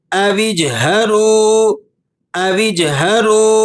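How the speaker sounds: اجْهَرُوا with a bouncing jolt on the jeem; qalqala on jeem. Shown as a demonstration. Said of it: "جیم پر آپ نے قلقلہ ادا کرنا ہے". (اجْهَرُوا میں جیم پر قلقلہ، جھٹکے کے ساتھ)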